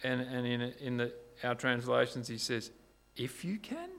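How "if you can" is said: "If you can?" thrown back, much higher and rising; incredulous echo. Retold or imitated. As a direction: echo the doubt back in disbelief. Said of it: "Jesus picks up on that".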